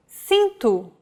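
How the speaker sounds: cinto with an s sound at the start; soft C. Shown as a demonstration. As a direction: say cinto as seen-too, c like s. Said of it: "or like this".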